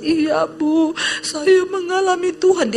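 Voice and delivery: high, imitated tearful voice; mock-weepy, high-pitched confession; tearful retelling. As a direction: act out someone overcome with tears, pitched well up. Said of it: "Dia nangis".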